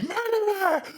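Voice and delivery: very high, squealing mock accusation; mock horrified cry. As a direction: shriek it in playful horror. Mock accusation over killed fruit fly.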